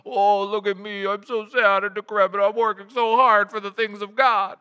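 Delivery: milk the misery for the crowd; mock mopey martyr. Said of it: "They're like".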